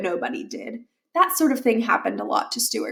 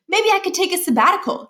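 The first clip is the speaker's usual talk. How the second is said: put-on higher, wheedling kid voice; a boy trying one more idea; eager young Miles; 9 semitones higher than usual. A narrator's reading. Miles pitching again.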